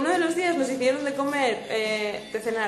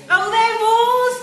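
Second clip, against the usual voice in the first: loud drawn-out greeting; 8 semitones higher, 10 dB louder, much slower than usual. Pretend. Greeting a fan on request, loud drawn-out call. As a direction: stretch it out, much higher and louder.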